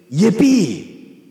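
high, loud whoop of delight; joyful cheer. Acted. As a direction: let out a gleeful hurrah. Exclaimed as a joyful amen.

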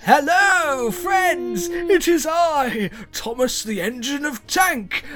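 high, loud, booming storybook train; a grand, pompous children's show engine; cheery cartoon engine. Game master voicing the talking train to players, high booming cheer.

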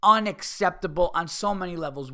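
usual speaking voice

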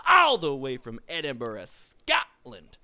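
loud, high, drawn-out introduction; wrestling ring announcer. Pretend. Host introducing co-host from Kirkcaldy, big ring announcement. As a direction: belt out the name and stretch it.

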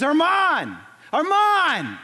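loud, high desperate call of a name. Retold or imitated. Father shouting for his son in the ruins, desperate high call. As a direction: cry out for his lost son across the rubble.

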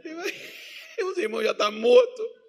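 high voice breaking into laughter; falando rindo. Real, not acted. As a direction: squeeze the words out through real laughter. Laughing through his own aside. (rindo de verdade, voz aguda)

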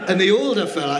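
ordinary talk

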